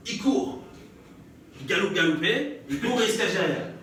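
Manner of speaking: usual speaking voice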